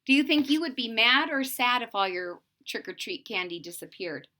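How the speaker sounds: ordinary delivery, nothing marked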